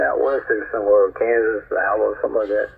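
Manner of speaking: usual speaking voice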